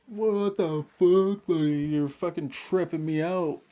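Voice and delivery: loud, high, slow shocked what the fuck; drawn-out disbelief at the stranger; freaked-out outburst. Retold or imitated. To the tall stranger, loud freaked-out outburst. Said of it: "I'm just like".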